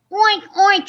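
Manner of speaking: high, loud playful oinking; pig oinks. Acted. Reader playing the pigs, loud oinks. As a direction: oink it out like two pigs. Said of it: "said Pig and Pig".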